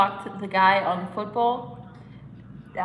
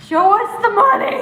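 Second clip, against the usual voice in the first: much higher, louder hammed-up bellow; Shane McMahon entrance yell; 11 semitones higher, 11 dB louder than usual. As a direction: scream it like a wrestling entrance. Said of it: "thought of Shane McMahon".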